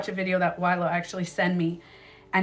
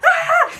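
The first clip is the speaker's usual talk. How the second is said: high, loud startled shriek; acted-out scream; 11 dB louder than usual. Retelling her shock at the figure, startled scream. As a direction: re-enact screaming in fright. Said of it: "and I went".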